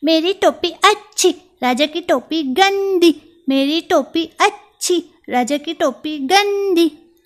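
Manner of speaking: high, sing-song chant called out; a mouse jeering outside the palace; shouted taunt. Acted. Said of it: "जोर-जोर से चिल्लाकर कहने लगा".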